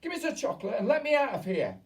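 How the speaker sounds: high, loud, fast demand; suspicious shout. A narrator's reading. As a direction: rush it, scared and suspicious. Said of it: "becoming suddenly suspicious".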